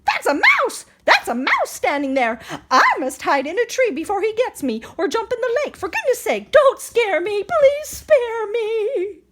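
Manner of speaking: high, panicked pleading; frightened elephant. Acted. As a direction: beg for mercy in a fright. Elephant terrified of mouse.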